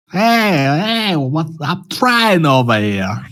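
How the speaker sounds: mock-tough New York guy complaining; New York accent. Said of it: "That's my New York accent".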